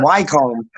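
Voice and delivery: "Wycombe" as WHY-comb; "Wycombe" read as spelt, why-combe; Wycombe mispronounced. Retold or imitated. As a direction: say "Wycombe" with a long i and a full "home" ending. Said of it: "It's fucking Wickham".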